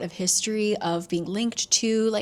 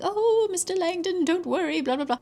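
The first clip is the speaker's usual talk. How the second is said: much higher, sugary hotel manager; fawning manager; 10 semitones higher than usual. Retold or imitated. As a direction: gush like an overly polite host.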